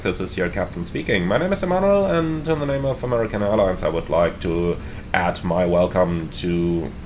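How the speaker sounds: pilot PA announcement; captain announcement. Acted. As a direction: welcome the passengers as captain. Captain welcome announcement to passengers.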